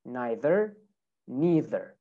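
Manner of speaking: American "neither" two ways, "nye-ther" then "nee-ther", R sounded; American accent. Shown as a demonstration. Teacher models American "neither" both ways, final R sounded. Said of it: "in America".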